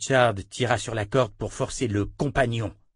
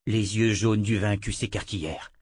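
plain reading voice

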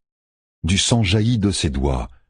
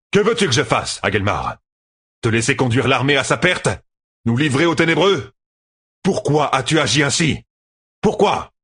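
high-pitched, heated questioning; angry confrontation; 8 semitones higher than usual. A narrator's reading. Commander demands why the general betrayed the army, heatedly. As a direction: fire accusing questions, pitch climbing.

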